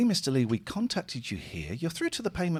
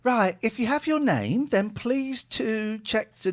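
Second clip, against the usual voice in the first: high call-centre woman; high lady voice; 9 semitones higher, slower than usual. Acted. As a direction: keep the voice high and polite. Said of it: "I'm a lady".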